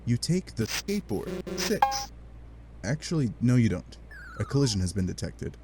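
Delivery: ordinary delivery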